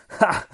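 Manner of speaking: sharp high burst of a laugh; mock character laugh. Streamer voicing a game character with a loud high laugh.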